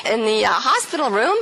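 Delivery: ordinary delivery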